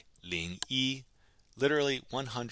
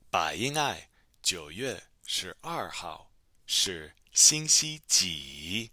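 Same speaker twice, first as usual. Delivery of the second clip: slow, louder dialogue reading; slow model reading; 6 dB louder, slower than usual. Shown as a demonstration. Teacher reading Gao's question to Bai Ying'ai, slow model reading. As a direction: read it out word by word for learners.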